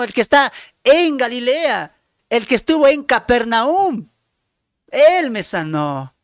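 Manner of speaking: high, loud, jubilant proclaiming; joyful testimony. Acted. Healed leper telling everyone about Jesus, loud joyful proclaiming. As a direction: shout the good news of your healing. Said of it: "¿Qué crees que habló él?".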